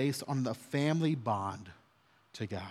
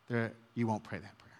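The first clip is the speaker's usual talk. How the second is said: ordinary talk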